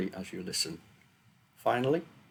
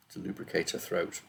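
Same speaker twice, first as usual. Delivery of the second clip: plain reading voice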